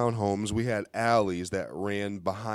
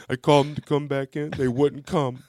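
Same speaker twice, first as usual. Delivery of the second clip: higher, mock-distraught retelling; tearful grandma; 6 semitones higher than usual. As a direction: imitate an upset grandma explaining. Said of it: "And she's crying".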